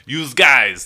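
tossing out "Yous guys" like a New Yorker; playful New York accent. Shown as a demonstration. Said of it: "that is fun to say".

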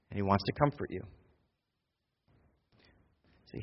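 quiet sincere comfort before the prayer; tender pastoral close. Acted. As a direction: speak gently as if to each one alone. Tender closing, near prayer.

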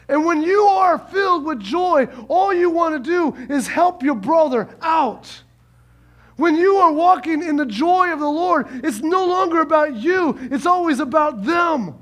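loud, high preaching build; rising sermon shout; preaching hard. Real, not acted. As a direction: preach it out with full force. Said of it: "Oh, I'm preaching good".

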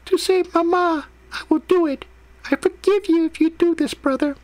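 put-on very high falsetto child voice; tiny put-on little girl, gently consenting; soft-spoken little sister. A narrator's reading. Sister gives her brother permission, high gentle child voice. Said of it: "She nodded and softly said".

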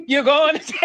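cracking up as she blurts it; real laughter, loud and high; laughing loud. Real, not acted. Laughing at friend's slip.

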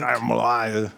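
attempted Creole accent on Jambalaya. Shown as a demonstration. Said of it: "if you do it in a Creole".

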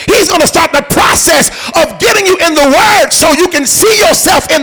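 loud rising sermon delivery; full-force preaching. Acted. Pastor driving home breakthrough to congregation, preaching loud.

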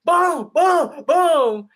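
high, loud booms; mock door slams; door slam sound. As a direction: hammer out each boom. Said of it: "was like".

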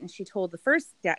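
usual speaking voice

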